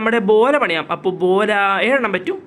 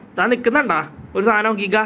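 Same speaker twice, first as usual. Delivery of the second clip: normal talking voice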